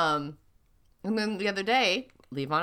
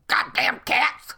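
grumpy dad; 4 semitones higher, 4 dB louder, slower than usual. Retold or imitated. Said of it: "he's the one that's like".

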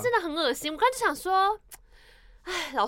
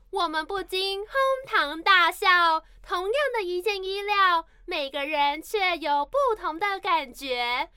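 recitation contest reading; 3 semitones higher, slower than usual. Shown as a demonstration. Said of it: "朗读比赛".

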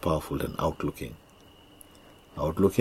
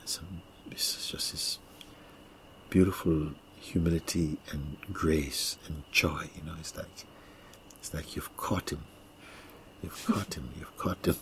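hushed spiritual guide; 8 dB quieter than usual. Acted.

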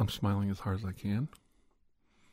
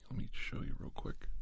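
soft unvoiced whisper; quick whispered aside; 8 dB quieter than usual. Real, not acted. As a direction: drop to a whisper as you show it. Aside while showing the mic.

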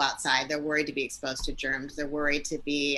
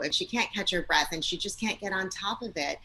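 ordinary talk

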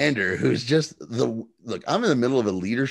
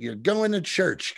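drawn-out, higher-pitched mock command; bossy order; 8 semitones higher, slower than usual. Sarah ordering him along with the rest, mock bossy command. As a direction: lay down the order slowly, pitched up. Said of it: "Sarah comes out and orders him".

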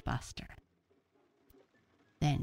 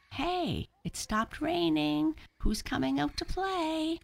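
high-pitched eager call to friends; excited calling out; 3 dB louder than usual. A narrator's reading. Buster calls friends out to play.

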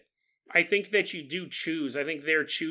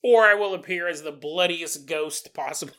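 ordinary talk, nothing marked

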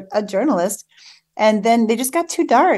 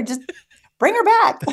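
laughing husband; 11 semitones higher, 3 dB louder than usual. Retold or imitated. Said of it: "So he was like".

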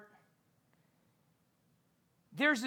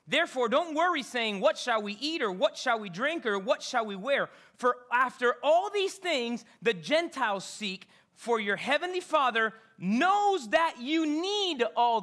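preaching scripture; 4 semitones higher, 6 dB louder than usual. Acted. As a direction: proclaim the verse with rising fervour. Reading scripture at full force.

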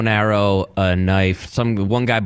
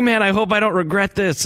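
frustrated survivalist; 11 semitones higher than usual. Retold or imitated. Voicing the contestant who can't light a fire, high mock whine. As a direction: whine about the wind, hammed up.

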